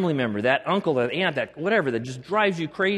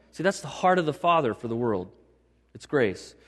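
ordinary talk

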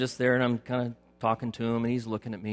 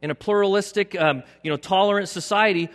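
raised, loud pulpit voice building; full-force preaching; 9 semitones higher, 6 dB louder than usual. Acted. Preacher rails at culture, loud full-force preaching. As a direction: drive it home to the congregation.

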